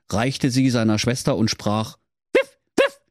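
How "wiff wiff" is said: very high yappy wiff; pug bark. Acted. Brudi the pug handing over the crowbar, high yapping bark. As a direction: yap like a tiny pug.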